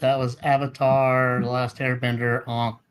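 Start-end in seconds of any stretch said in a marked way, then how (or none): 2.5-2.8 "on" with an open ah, as in "Ong"; mispronounced Aang. Real, not acted. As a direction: say "on" like "ahng", rhyming with "song". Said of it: "It's pronounced Ong".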